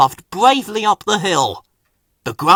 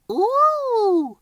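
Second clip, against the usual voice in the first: put-on children's ooh. The children marvel.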